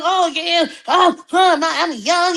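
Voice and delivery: mocking Monique. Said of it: "telling all us old bitches how young".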